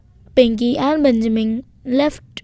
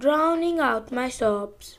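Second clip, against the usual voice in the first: plain reading voice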